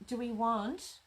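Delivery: ordinary talk, nothing marked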